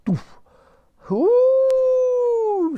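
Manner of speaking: very high hooting mammoth call; long high trumpeting hoo; mammoth sigh. A narrator's reading. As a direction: let out a huge animal hoot. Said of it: "she sighed".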